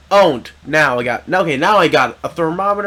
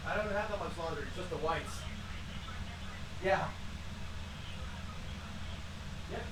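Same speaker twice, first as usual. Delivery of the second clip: distant, off-mic phone talk; talking away from the microphone; far from mic; 25 dB quieter, faster than usual. Real, not acted.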